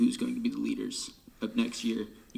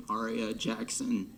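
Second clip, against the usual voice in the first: normal talking voice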